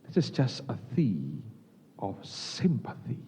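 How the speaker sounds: soft, slow, gracious English; gentle polite offer. Retold or imitated. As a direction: offer the tea humbly and tenderly. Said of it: "Dia berkata". (tawaran lembut dan pelan)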